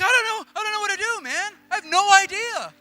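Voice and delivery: high, loud, frantic protest; hammed-up flustered outburst; mock panic. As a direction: blurt it out in helpless panic. Acts out his clueless panic.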